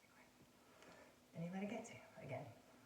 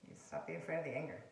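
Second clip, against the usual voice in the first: normal talking voice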